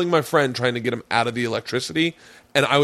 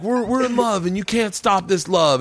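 mock proud lover; 8 semitones higher than usual. Friend showing off to heckler, mock proud declaration. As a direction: proclaim love to the heckler, hammed-up. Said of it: "he's like".

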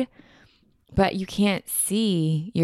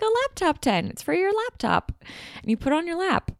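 much higher, sing-song mom explaining; 12 semitones higher, faster than usual. Retold or imitated. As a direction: patiently spell it out as mom. Mom explains the gift again.

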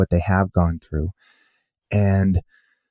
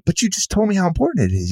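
much higher, exasperated protest; can't believe what he's hearing; retold disbelief; 12 semitones higher, faster than usual. Author to the dad, high exasperated disbelief. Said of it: "And I'm like".

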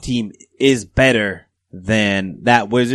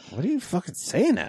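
retold bafflement; 6 semitones higher, 4 dB quieter than usual. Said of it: "Remember somebody goes like".

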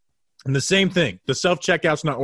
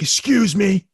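berating stranger; 7 semitones higher than usual. Retold or imitated. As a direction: imitate an angry guy scolding a woman. Guy berating woman, retold.

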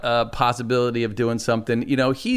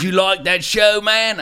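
higher, louder hammed-up Aussie question; terrible Australian accent; 7 semitones higher, 4 dB louder, slower than usual. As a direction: ask like an eager Australian fan, badly imitated. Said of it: "I'm gonna do a terrible Australian accent".

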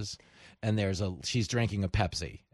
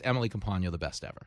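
ordinary talk, nothing marked

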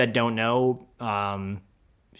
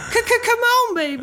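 high, loud rock-star squeal; French fry guy shrieking in falsetto; 5 dB louder, slower than usual. Acted. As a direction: stutter it like a glam rocker. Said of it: "he sounds like [name]".

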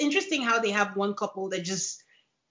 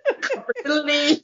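laughing through it; 5 semitones higher than usual. Real, not acted. Host quoting the husband's list of needs, cracking up.

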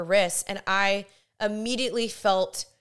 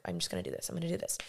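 low, soft mutter to oneself; panicking self-talk; 13 semitones lower, 12 dB quieter, much faster than usual. Retold or imitated. Spotting the girls again, quietly muttered panicky self-talk. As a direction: psych yourself up under your breath. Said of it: "And I'm, like, panicking".

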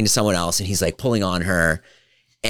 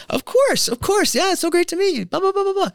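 gushing, much higher falsetto, fast; over-eager falsetto. Retold or imitated. Eager to please the kid, gushing falsetto. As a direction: pile on the niceties in a squeaky high voice.

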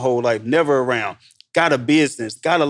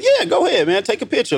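higher, generous and eager; eager retold reply; 9 semitones higher than usual. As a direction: happily wave him on in a raised voice. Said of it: "I said".

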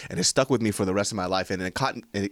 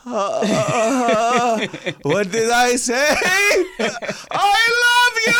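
shouting while laughing, high; 8 dB louder, much slower than usual. Pretend. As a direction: cry it out through laughter. Hammed-up romantic outburst.

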